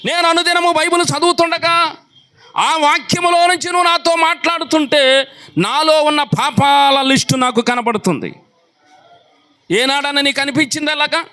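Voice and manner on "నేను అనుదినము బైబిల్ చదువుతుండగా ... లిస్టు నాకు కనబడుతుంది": high, driving sermon voice; impassioned pulpit delivery; fiery preaching. Acted. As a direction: rouse the congregation. Preaching at full force.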